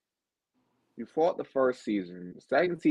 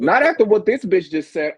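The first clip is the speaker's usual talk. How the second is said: louder, higher imitation of an offended guy; mock outburst; 9 semitones higher, 9 dB louder than usual. Host imitating Rivers to the guest, a loud mock outburst. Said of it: "He was like".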